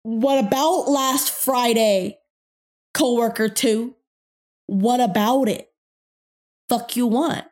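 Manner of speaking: loud, slow, irritated inner retort; angry inner voice. Retold or imitated. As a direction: snap back in your head, fed up. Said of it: "in my brain, I'm like".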